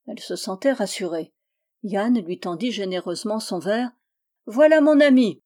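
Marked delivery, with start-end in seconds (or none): 4.5-5.4 loud, high proud call; crying out. A narrator's reading. Yann showing off his friend to Rose Eyre, crying out. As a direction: call it out to the room. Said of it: "cria-t-il en me montrant".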